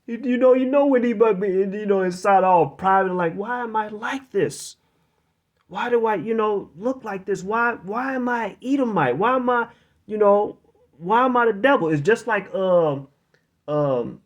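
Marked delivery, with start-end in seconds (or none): 3.3-10.6 a hint of private anguish, higher-pitched; mild, high-pitched self-questioning; lightly voiced Esau. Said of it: "So Esau's wondering, like". 11.0-11.9 higher-pitched, questioning in mock anguish; a hint of anguish, high voice; lightly voiced Esau. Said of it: "So Esau's wondering, like".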